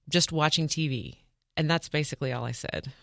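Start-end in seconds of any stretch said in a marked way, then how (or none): none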